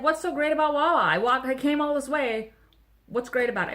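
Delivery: loud, pushy retold question; aggressive demand. Shopper retelling how she grilled the Wawa manager, aggressive. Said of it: "It was kind of aggressive".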